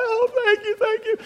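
very high, crying thank-yous; tearful thanks. Retold or imitated. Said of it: "he's like".

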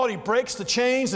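normal talking voice

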